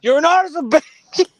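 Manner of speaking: high, loud disbelieving yell; incredulous. Real, not acted. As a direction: shout in baffled disbelief. Incredulous shouting at bacon in bed.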